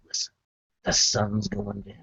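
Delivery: ordinary talk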